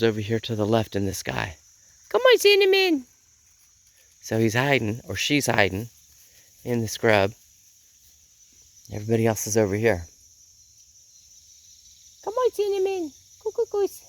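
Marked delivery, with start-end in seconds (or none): 2.1-3.1 loud, very high pet call; calling a chicken. Real, not acted. Owner calls Cinnamon back to the others, high coaxing call. 12.2-14.0 very high cooing pet call; calling a chicken. Real, not acted. Owner coaxes hiding Cinnamon out, high cooing call.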